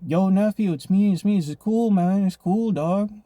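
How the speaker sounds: mock plea from a man facing a knife; placating street guy. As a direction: talk him down fast, "it's cool". Homeless man placating the knife-holder.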